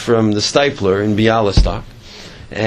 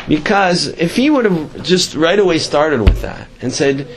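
ordinary talk, nothing marked

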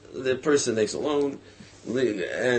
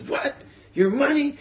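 indignant disbelief; 12 semitones higher than usual. Retold or imitated. Debtor reacting to wife's demand.

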